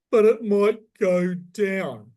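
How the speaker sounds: slow, loud, high whine of a scared investor; mock whiny fear. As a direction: drag it out like a timid buyer. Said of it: "He goes".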